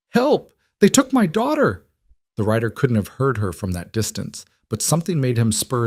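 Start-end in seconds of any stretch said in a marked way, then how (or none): 0.1-1.8 straining cry. A narrator's reading. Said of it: "forcing the word past her raw throat".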